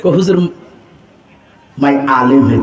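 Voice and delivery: ordinary talk, nothing marked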